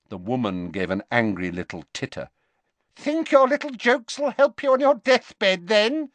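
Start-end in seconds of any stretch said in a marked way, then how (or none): 3.0-6.1 much higher and louder taunt; mock him cruelly, sneering; jeering. A narrator's reading. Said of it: "she jeered".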